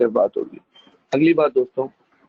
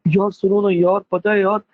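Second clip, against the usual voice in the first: higher, pushy friendly nagging; teacher imitating an overfamiliar chatty friend; eager pal; 6 semitones higher than usual. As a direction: nudge your friend to listen, a touch hammed up. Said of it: "ये वाला जो मिजाज होता है ना".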